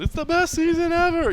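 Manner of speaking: high-pitched, loud promoter hype; hyping promoter. Acted. Podcast host mocking a fight promoter overselling the show, hammed-up hype. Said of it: "that's not the promoter going".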